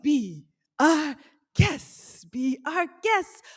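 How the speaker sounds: slow, drawn-out showman's welcome; Lumiere. Acted. Welcoming guests like Beauty and the Beast's candelabra, grandly. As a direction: stretch each word out theatrically. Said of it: "What did Lumiere say?".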